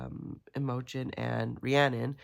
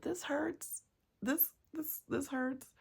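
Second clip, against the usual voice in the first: pained outcry; 10 semitones higher, 5 dB quieter, slower than usual. Real, not acted. Reader pained by chapter.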